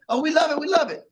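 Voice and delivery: high, laughing fake gushing; high-pitched mock delight with a laugh; gushing fan voice. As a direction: play the adoring fan who loves anything. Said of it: "[name] sung".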